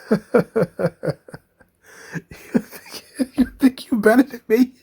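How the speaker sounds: high, laughing Nikki voice. Retold or imitated. Host laughing while mimicking Nikki, high. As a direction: break up laughing while doing her. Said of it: "the Nikki voice".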